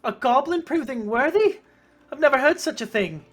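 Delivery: high, loud, scandalized villager voice; high-pitched, loud disbelief; incredulous villager. Acted. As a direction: scoff loudly at the very idea. Villager scoffs at sparing the goblin.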